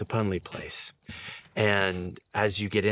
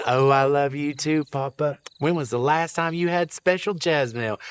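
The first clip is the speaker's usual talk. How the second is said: put-on higher old woman's voice, doting; sweet old wife, voice raised; old mama, fond; 6 semitones higher, 5 dB louder than usual. Old wife answering her husband, fond and higher. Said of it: "She says".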